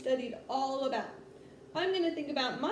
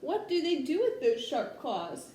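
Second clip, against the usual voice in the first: ordinary delivery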